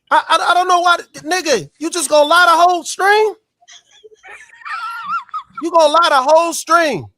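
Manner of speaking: heated accusation. Real, not acted. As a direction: call him out loudly.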